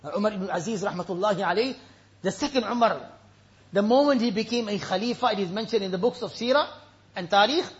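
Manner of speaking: low, hushed sermon voice; subdued, weighty delivery; solemn preaching. Real, not acted. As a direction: let the warning sink in gravely. Solemn verse and warning.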